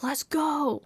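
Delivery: high mock hype shout; hype cheer. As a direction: cheer a coworker on. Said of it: "he was like".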